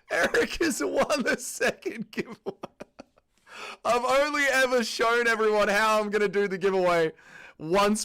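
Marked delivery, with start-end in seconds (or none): 0.1-2.4 laughing through the words, higher; giggling disbelief. Real, not acted. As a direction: announce it while cracking up. Announcing while still laughing.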